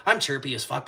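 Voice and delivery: normal talking voice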